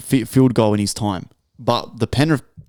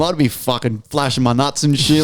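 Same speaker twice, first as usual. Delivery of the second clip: ordinary talk, nothing marked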